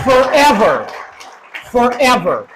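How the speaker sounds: high, loud, drawn-out shout of forever; emphatic shout. Real, not acted. Speaker to supporters, loud emphatic shout. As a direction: hammer the word home to a cheering crowd. Crowd cheers, he doubles down.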